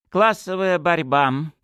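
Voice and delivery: slow, thoughtful rise; musing. A narrator's reading. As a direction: turn the words over thoughtfully. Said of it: "задумчиво вымолвил глава колледжа".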